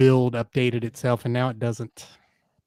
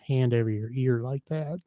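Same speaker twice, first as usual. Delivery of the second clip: usual speaking voice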